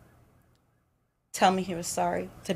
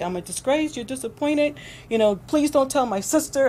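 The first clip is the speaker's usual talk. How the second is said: higher, mock-tearful whimper; imitating his crying; 8 semitones higher, 3 dB louder than usual. Woman imitates her abuser crying and begging, in a higher mock-tearful whine. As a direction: pitch it up like a grown man blubbering. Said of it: "he started crying".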